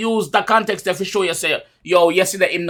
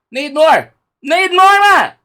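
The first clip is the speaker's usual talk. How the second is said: loud, high, rising demand; fired-up, high-pitched insistence; insistent urging; 11 semitones higher, 7 dB louder, slower than usual. Real, not acted. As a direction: call out for more, louder and higher. Urging more recognition for artists.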